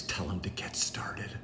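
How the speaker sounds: whispered aside. Real, not acted. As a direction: drop to a whisper.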